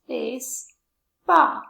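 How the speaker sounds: BASEBALL slow and firm, as "base... ball"; syllable by syllable. Shown as a demonstration. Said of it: "Baseball has two syllables".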